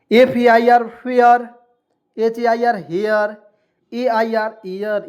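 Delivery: letters spelled out slowly and loudly, then the word फेयर, हेयर, एयर; teacher spelling letter by letter, then saying the word; spelled out and modelled. Shown as a demonstration. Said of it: "spelling then modelling the word".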